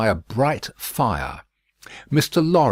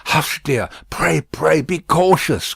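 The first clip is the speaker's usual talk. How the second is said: higher, urgent hushing plea; pleading Lucy; 6 semitones higher, 4 dB louder than usual. A narrator's reading. Lucy urgently hushing Miss Pross, higher. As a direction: beg her friend to be careful. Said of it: "cried Lucy".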